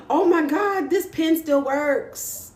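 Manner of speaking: gasp, then high, drawn-out amazement; amazed gasp. Real, not acted. Vlogger amazed that the pen still works, gasping surprise. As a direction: marvel at it, slowing down.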